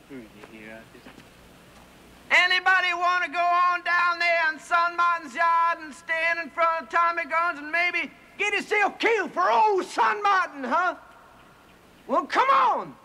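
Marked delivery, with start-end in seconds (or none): 2.3-13.0 shouting to crowd. Acted. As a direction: bellow a challenge to the whole crowd. Calling out to the crowd.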